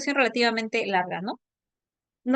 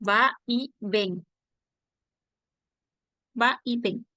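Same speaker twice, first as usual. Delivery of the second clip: "Va y ven" spelled out slowly, each piece apart; split into parts; slower than usual. Shown as a demonstration. Teacher to Jorge, splitting vaivén: slow "Va y ven". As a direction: separate "Va y ven" word by word. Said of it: "pero es va y ven".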